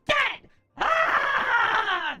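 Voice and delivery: hammed-up villain roar; loud. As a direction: explode on the last word. Villain outburst.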